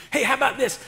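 usual speaking voice

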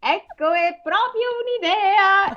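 high, drawn-out princess exclamation; hammed-up high storybook cry; exclaimed. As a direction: exclaim it slowly as a sudden bright idea. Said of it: "esclamò".

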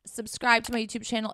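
normal talking voice

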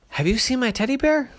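high, excited little bear cub voice; a bright eager cub asking around. A narrator's reading.

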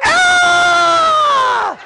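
put-on long, very high girls' shriek; loud mock scream of middle school girls; horrified scream. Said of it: "in horror".

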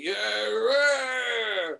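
cheesy Santa entrance. Retold or imitated. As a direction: swagger in as the bad Santa. Santa greeting party guests.

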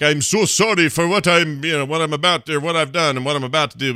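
dying father's apology. Retold or imitated. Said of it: "he's like".

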